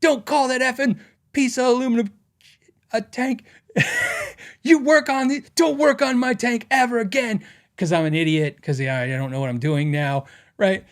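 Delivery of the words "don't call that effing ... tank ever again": angry tank driver. Retold or imitated. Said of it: "But he was cussing".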